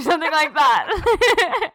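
breaking into high laughter; laughing. Real, not acted. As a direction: laugh through the words.

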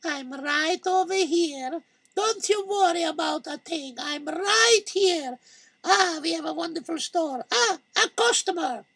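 sly shopkeeper voice, pitched high; Malky. Acted. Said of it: "and Malky?".

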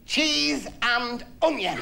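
drawn-out, very slow call; wife calling out a crisp flavour; slow flavour guess. Acted. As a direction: stretch each word out.

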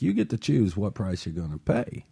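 usual speaking voice

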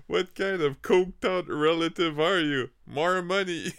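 slow, loud mock tirade; mock angry rant. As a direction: bark it like an outraged stand-up. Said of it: "Puis il était comme". (tirade indignée, lente et forte)

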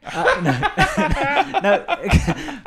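high laughing no. Real, not acted. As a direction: laugh it off, pitch up. Laughing at own joke.

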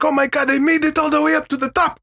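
thrilled high-pitched cheer; very high excited. Retold or imitated. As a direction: squeal it way up high. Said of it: "I was like".

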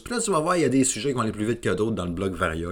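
ordinary delivery

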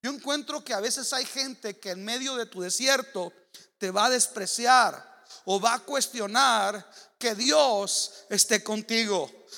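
ordinary talk

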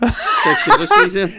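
high, loud words squeezed through laughter; laughing hard. Real, not acted. Joking about Joyce, he laughs through the words. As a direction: laugh the punchline out.

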